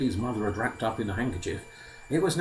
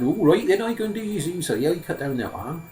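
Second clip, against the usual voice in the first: higher-pitched obliging simple lad; naive Simpleton, agreeable; 7 semitones higher, 4 dB louder than usual. Acted. Simpleton does as the old man says, simply agreeable. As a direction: give in readily, in an artless put-on voice.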